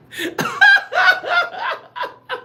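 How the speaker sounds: long, very high giggling fit; squealing laughter that tails off; real laugh. Real, not acted. Cracking up at crude chat.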